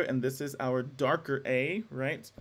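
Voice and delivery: usual speaking voice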